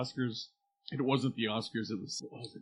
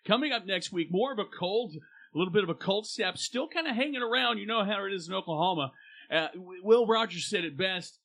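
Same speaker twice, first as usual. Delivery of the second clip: higher, upbeat radio host read; radio DJ announcer; 6 semitones higher, 4 dB louder than usual. Pretend. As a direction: sell it like a local morning DJ.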